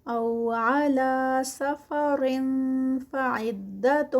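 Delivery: slow, drawn-out tajweed recitation; Quranic recitation. Shown as a demonstration. Teacher reciting the verse for students, slow and melodic. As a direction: chant each word slowly, stretching the vowels.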